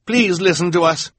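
put-on high urgent boy voice; lightly voiced urgent boy; pleading Klaus. A narrator's reading. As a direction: beg them to listen. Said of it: "Klaus said".

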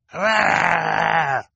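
high loud growled cheer; growling baby cheer. A narrator's reading. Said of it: "growled Sonny".

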